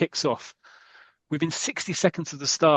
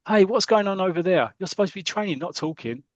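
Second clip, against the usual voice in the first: calling out sharply; 6 semitones higher than usual. Acted. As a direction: call out to players across the pitch. Coach calls out to chatting players.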